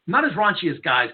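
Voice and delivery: ordinary delivery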